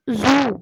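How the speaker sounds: जू with a drawn-out buzzing z at the start; demonstrated Z sound. Shown as a demonstration. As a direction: say जू loudly, leaning on the opening z. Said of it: "जू वाली ज".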